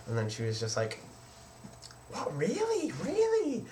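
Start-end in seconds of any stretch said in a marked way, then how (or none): 2.1-3.7 high-pitched mock disbelief; sister's disbelief. Sister reacting to his secret, high mock-shocked squeal. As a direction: squeal it, imitating a stunned sister.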